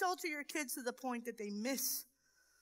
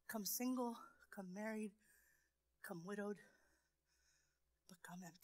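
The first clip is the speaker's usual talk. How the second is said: much softer, near-whispered invitation; gentle fading sermon call; hushed altar call; 6 semitones lower, 14 dB quieter than usual. Acted. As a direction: draw them in with a soft plea. Softly preaching an invitation.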